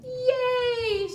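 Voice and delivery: high excited cheer; a tiny spider clapping and cheering. Acted. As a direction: squeal it like a delighted spider. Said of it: "she says".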